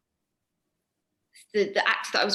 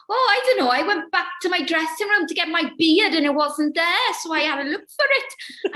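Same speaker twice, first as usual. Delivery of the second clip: put-on high, loud, lilting Welsh accent; 8 semitones higher, 5 dB louder than usual. Welsh actor explaining why he missed his entrance, strong Welsh accent. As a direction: imitate a comical Welsh speaker excusing himself. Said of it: "offend any Welsh people with my accent".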